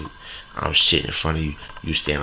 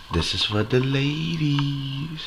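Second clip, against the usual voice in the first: much higher, very slow, drawn-out radio dedication; a slow, high-pitched shout-out to the ladies; slow smooth dedication; 8 semitones higher, much slower than usual. Acted. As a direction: croon it out slowly like a late-night DJ.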